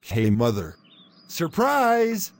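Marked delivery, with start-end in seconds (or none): 1.3-2.3 high bright "Surprise!" shout; spring it on her, pitch way up; excited surprise. Acted. Sons surprise mom with clean home.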